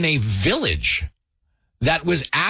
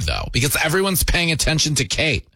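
normal talking voice

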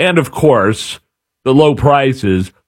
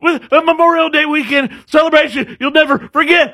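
big booming announcer hype, pitched way up; excited radio voice; faster than usual. Pretend. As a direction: sell it like a race-day promo. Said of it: "in your most excited radio voice".

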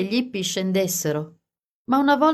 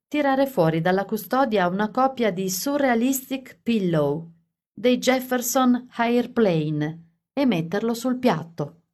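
plain reading voice

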